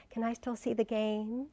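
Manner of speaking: put-on high, soft girlish stage voice; girl's line. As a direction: deliver it like the naive new girl.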